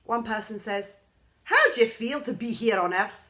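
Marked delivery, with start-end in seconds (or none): 1.4-3.2 a bright, louder question to a newcomer; curious stranger. A narrator's reading. Stranger greeting the newly landed girl, eager and loud. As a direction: ask it eagerly, higher.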